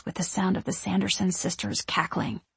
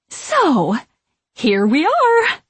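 slow, much higher, loud witch flourish; grand announcement; 8 semitones higher, 7 dB louder, much slower than usual. A narrator's reading. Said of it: "she announces".

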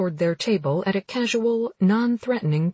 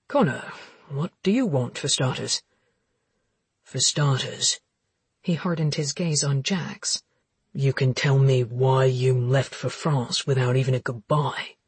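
deep, cold male voice; hard, accusing low voice; low brooding man; 5 semitones lower than usual. Acted. Connor voiced in dialogue.

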